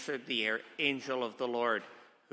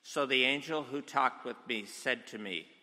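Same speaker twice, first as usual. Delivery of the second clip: ordinary talk, nothing marked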